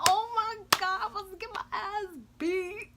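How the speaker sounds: high, loud, hammed-up dread; retold panic. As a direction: blurt it out as if you're in big trouble. Retelling panic at mom's anger.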